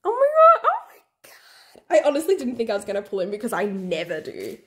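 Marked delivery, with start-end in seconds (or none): 0.0-2.0 high, overwhelmed breathy squeal; about to cry. Real, not acted. Fan about to cry over the pull, overwhelmed. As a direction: let it dissolve into airy squeaks. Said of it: "I'm gonna cry".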